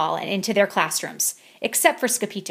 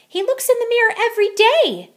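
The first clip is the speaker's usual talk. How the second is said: sisters tattling; 14 semitones higher, 6 dB louder than usual. Acted.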